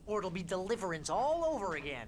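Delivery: ordinary talk, nothing marked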